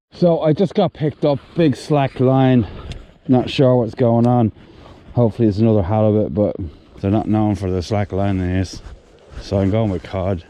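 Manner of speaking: loudly narrating, strained with effort; loud and excited. Real, not acted. Fighting a big fish.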